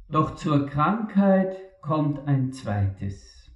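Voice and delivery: ordinary talk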